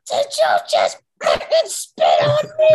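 furious yell. Retold or imitated. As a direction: coach screams at player who spat on his shoe. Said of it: "And he goes".

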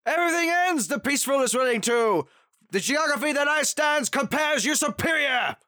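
high, loud, shouted bad-dub villain line; meme dub impression. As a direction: ham up the badly dubbed villain. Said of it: "this meme".

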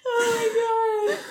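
high, slow, laughing cry; real laughter breaking through the words. Real, not acted. Friend laughs hard at the pilot joke, slow and high.

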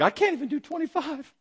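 high, thin recruit's whine; mock whine. Recruit told fifty became a hundred, mock whine. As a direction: squeak out a helpless complaint. Recruit whining at doubled push-ups.

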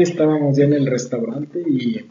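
usual speaking voice

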